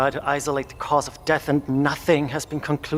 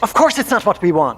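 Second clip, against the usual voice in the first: angry retort; 6 semitones higher, 6 dB louder than usual. Acted. Heated argument over sacrifice.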